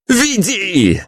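high cracking shout; voice breaking. A narrator's reading. Hero orders the guard, voice cracking high. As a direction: yell it as the voice breaks. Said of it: "срывающимся голосом крикнул я".

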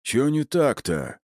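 puzzled lunk, rising in pitch; booming hulk. A narrator's reading. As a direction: ask slowly, like a baffled strongman. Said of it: "прогудел он".

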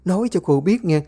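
shrill-voiced rebuke of the family squabble; scolding mother-in-law. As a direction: tear into them like a furious mother-in-law.

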